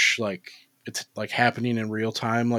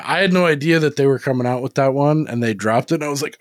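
ordinary talk

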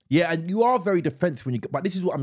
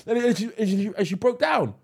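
usual speaking voice

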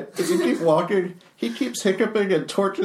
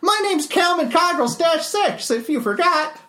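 high Calvin voice; 14 semitones higher, 3 dB louder than usual. Acted. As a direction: voice the little construct brightly.

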